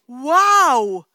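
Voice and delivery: loud joyful burst; amazed wow. Retold or imitated. Couple realise they are saved, loud amazed shout. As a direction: shout it in delighted wonder.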